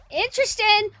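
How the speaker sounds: high, loud exclamation; loud, high-pitched burst of interest; excited discovery. Real, not acted. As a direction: cry it out, suddenly thrilled.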